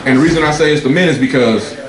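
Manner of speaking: usual speaking voice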